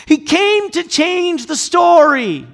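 slow, loud, high declaration; full-force preaching. Acted. Preacher declaring Christ's purpose, slow and loud. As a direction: land the line with weight.

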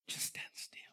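whispered hush. Retold or imitated. God telling the restless to stand still, in a whisper. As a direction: breathe it out in a whisper. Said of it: "that's what God is saying".

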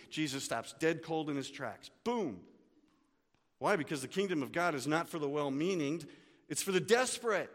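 ordinary talk, nothing marked

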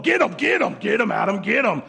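high, eager egging-on shout; mock cheering. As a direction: egg the fight on like a fan. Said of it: "You're like".

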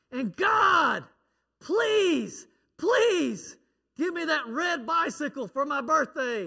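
put-on boy screaming his prayer. Little boy screaming his bedtime prayer at God. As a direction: yell it up to heaven like a kid. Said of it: "You don't have to scream".

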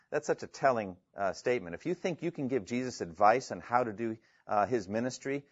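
ordinary talk, nothing marked

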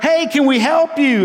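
half-hearted hail to another ship; calling out. Retold or imitated. Peruvian sailors hail the stricken Spanish ship, mild call-out. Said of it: "the Peruvians just shout up".

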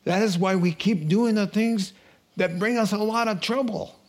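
usual speaking voice

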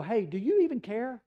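loud, high-pitched acted indignation; exasperated mock appeal; indignant reproach. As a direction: challenge him as if he's ignoring you. Disciples reproach Jesus in the storm.

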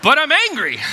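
put-on high, loud childish protest with a laugh; whiny child. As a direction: play the sulky kid who talks back. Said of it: "they're like".

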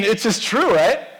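usual speaking voice

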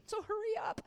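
high-pitched playful nudge; squeaky-high mock hurry; mock impatient. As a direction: pipe up high, pretending to rush someone along. Impatient reader nudging God along.

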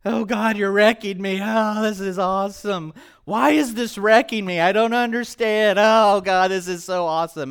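overwhelmed crying out. Retold or imitated. Talking to God while crying his eyes out, loud and high. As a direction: cry it out, caught between awe and tears. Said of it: "it goes something like this, I'm like".

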